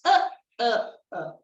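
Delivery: loud chugging uh uh; mock machine sound; mixer noise. Shown as a demonstration. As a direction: imitate a mixer engine. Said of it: "The mixer in the ball goes".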